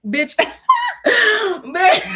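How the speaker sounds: high squealing laugh around bitch; shrieking laughter. Real, not acted. Laughing at being a messy Gemini, high shriek. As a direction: cackle it out. Cackling at her own gossip.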